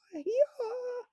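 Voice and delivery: high, far braying hee-haw; donkey bray. Acted. Donkey braying for joy, high bray. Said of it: "gave a grand haw".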